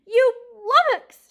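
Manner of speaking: very loud, high, slow insult; furious cry. A narrator's reading. Mouse furious at the porcupine, loud high insult. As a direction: scream it at the porcupine. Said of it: "she cried".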